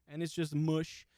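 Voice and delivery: ordinary talk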